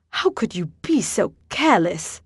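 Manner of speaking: mock British accent, accusing; a put-on British accent, accusing; fake British accent. A narrator's reading. Theodore accusing Bertie in a fake British accent. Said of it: "in Theodore's fake British accent".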